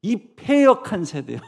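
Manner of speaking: loud, high angry scolding. Acted. Jesus scolding his disciples, loud and angry. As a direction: tear into them. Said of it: "막 어떻게 혼내요".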